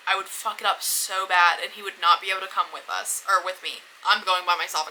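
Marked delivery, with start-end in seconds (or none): none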